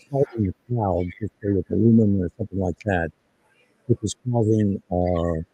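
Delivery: muffled, as if through a hand; words muffled behind a hand. Real, not acted. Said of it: "You're muffled".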